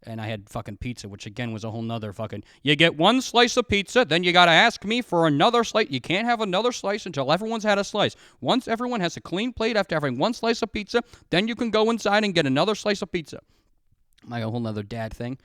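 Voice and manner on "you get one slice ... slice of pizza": higher, louder dad laying down rules; mock strict dad. A dad rationing pizza to the kids, mock strict lecture. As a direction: lecture like a rule-bound father.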